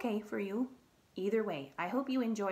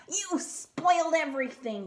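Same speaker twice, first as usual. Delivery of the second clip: high, loud furious yell; angry shout; 7 semitones higher, 7 dB louder than usual. Acted. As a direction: scream it at the princess. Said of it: "he was shouting at her".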